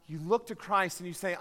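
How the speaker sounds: ordinary talk, nothing marked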